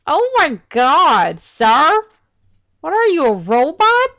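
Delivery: high and loud, gleefully baffled; amazed yelling. Real, not acted. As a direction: yell in delighted disbelief. Reacting to weird robot movements.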